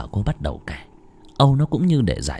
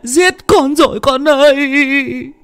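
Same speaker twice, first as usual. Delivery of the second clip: high, loud, slow sobbing wail; grieving mother, guilt-stricken; 6 dB louder, slower than usual. Acted. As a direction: break down in self-blame. Mother blames herself, wailing.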